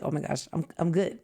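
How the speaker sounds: normal talking voice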